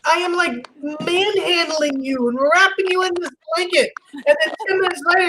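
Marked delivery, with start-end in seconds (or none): none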